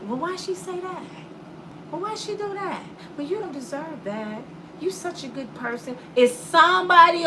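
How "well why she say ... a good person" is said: sweet other woman. Retold or imitated. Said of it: "His friend".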